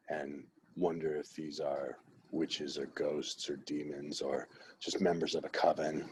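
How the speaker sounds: low, hushed, creepy storyteller voice for a Halloween reading of the painting; spooky Halloween voice. Pretend. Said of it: "I think just my voice is disturbing".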